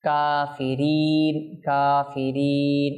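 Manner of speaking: slowly intoned কাফিরিন with a drawn-out final "ee"; madd lengthening. Shown as a demonstration. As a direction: stretch the long vowels of কাফিরিন on a level tone. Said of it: "এক আলিফ হবে". (কাফিরিন টেনে মদ করে)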